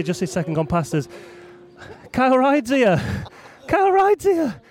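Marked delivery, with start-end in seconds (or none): 2.1-3.0 raised, eager call that Kyle Ryde's here; excited spotting. Real, not acted. 3.7-4.6 much higher and louder: Kyle Ryde's here; thrilled repeat. Real, not acted.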